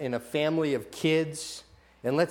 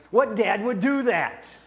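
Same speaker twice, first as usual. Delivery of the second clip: incredulous; 5 semitones higher than usual. Acted. Incredulous rhetorical question to congregation.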